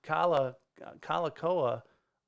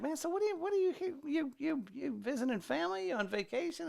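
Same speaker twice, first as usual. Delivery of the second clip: much higher, lightly voiced Hawaiian host; imitating the host; 12 semitones higher, 3 dB quieter than usual. Lightly imitating the Hawaiian host asking about his trip. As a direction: ask it the way the friendly host did.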